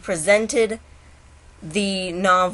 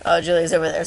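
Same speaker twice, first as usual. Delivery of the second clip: normal talking voice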